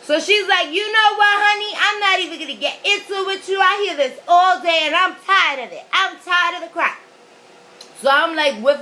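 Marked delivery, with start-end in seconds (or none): 0.7-7.0 high, loud mock rant of a fed-up clerk. Clerk refusing to argue with a complaining customer, fed up. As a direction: act out an exasperated clerk snapping.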